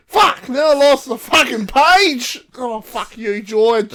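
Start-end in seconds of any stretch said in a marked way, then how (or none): 0.1-2.4 loud, slow exasperated complaint; annoyed mum. Retold or imitated. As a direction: gripe about losing the page.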